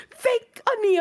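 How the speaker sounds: high, pushing the clue; mock insistent sister. Imitating her sister guessing at lyrics, high and insistent.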